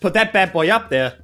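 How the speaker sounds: usual speaking voice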